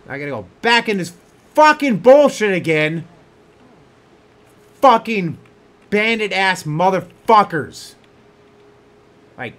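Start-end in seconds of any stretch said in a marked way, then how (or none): 0.6-7.9 fast, then loud and high curses; raging gamer rant; angry rant. Real, not acted. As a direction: vent real fury at the bandits. Raging at the bandits.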